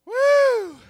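long, loud, high-pitched woo; loud high whoop. Real, not acted. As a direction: hold a piercing whoop. Preacher's whoop.

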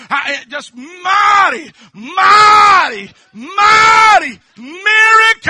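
high, loud, slow shouted climax; preaching shout. Real, not acted. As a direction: cry it out in full preaching fervour.

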